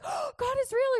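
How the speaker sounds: high, gasping mock amazement; awestruck reaction. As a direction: gasp it out as a sudden revelation. Mock reaction to receiving a word.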